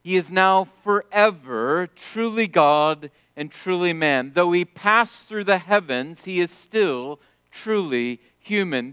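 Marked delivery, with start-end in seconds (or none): none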